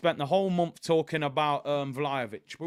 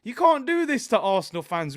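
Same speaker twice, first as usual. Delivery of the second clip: excited gloating; 7 semitones higher, 6 dB louder than usual. Real, not acted. Gloating reply to rival fan.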